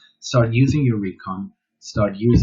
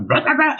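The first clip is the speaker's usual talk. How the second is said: mock dog barking, rah rah, higher and louder; imitated barking; 6 dB louder than usual. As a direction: bark it out like an excited dog. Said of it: "When they start".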